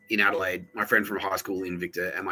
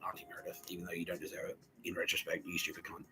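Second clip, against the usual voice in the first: muttered aside; 3 semitones lower, 17 dB quieter than usual. Retold or imitated. Writer muttering an insult at Auntie Meredith, near whisper. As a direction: insult dropped to a near whisper, much softer.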